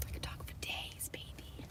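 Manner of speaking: barely audible playful whisper; whispered boast. Real, not acted. Streamer answering viewer about still talking, playful whisper. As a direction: whisper it confidingly.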